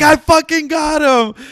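very high, loud, stretched-out crowing; retold triumphant yell. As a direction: imitate a prankster yelling in victory. Prankster's reveal repeated at full force.

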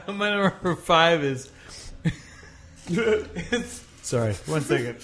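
giggling through the words; can't stop laughing while answering. Real, not acted. Cracking up mid-answer.

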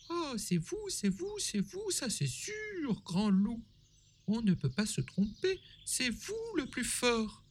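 put-on very high little girl voice. A narrator's reading. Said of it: "répond la petite fille".

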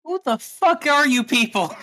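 high, loud, bewildered fury; outraged demand. Acted. Cecile demands answers from her rescuers, outraged. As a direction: demand it loudly, pitched right up.

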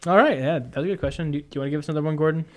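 ordinary delivery

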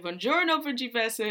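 loud, high, beaming Italian hello; cheerful greeting. Retold or imitated. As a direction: burst in with a big delighted buongiorno.

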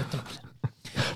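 slow breathy whisper. Pretend. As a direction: whisper it slowly, dismayed. Repeats phrase, second whispered.

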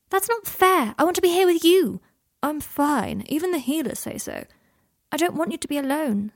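put-on high, loud child's protest easing off; protesting boy. A narrator's reading. Scorpius objects to leaving his father, high and insistent. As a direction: plead like an upset young son. Son protests being sent to school.

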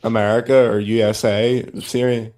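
ordinary delivery

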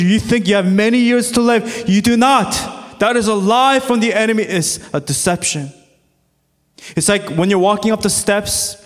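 full-force pulpit preaching. Acted. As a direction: drive the warning home to the congregation.

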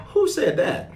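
high-pitched, incredulous challenge; mock incredulity. Preacher reacts to a salt-water meme, mock incredulous. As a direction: question it in comic disbelief.

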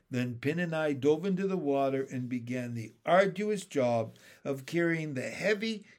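usual speaking voice

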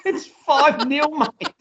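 high-pitched, laughing through the words; can't get it out for laughing; slow, giggly disbelief. Real, not acted. Laughing at commentator's quote.

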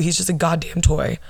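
ordinary talk, nothing marked

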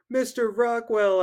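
high loud call for help; calling the gym teacher for help; bellow. Acted. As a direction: shout his name across the gym. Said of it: "I bellowed".

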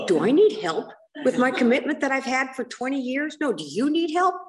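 high, rising, outraged questions; indignant retort. Retold or imitated. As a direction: toss the question back, offended.

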